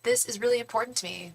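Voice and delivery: ordinary talk, nothing marked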